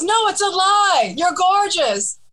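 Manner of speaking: high, slow playful reassurance; flirty correction. Retold or imitated. Musician flattering a fan through the limo window, high and slow. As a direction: coo the compliment slowly and sweetly. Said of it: "and [name] goes".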